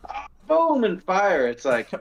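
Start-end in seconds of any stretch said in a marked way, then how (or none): 0.1-0.8 much higher, playful boom like a gun going off; imitated gunshot boom; mock gunshot. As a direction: blurt out a gunshot noise. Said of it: "I'm not just gonna".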